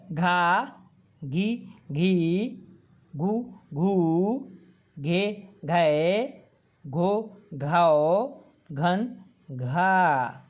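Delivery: slow, deliberate recitation of each syllable; teacher reciting the barahkhadi chart one syllable at a time; syllable drill. Shown as a demonstration. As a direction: call out each sound clearly for the class to repeat.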